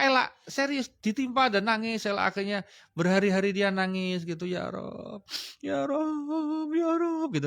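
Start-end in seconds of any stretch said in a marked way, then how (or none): 4.4-7.3 mock tearful plea. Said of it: "nangisnya sampai terseduh-seduh".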